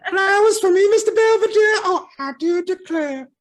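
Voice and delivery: high, hammed-up Southern belle voice, half laughing. Teasing a Southern friend, doing a high mock Southern belle. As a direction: swoon it out like a fluttering belle. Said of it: "Southern Belle".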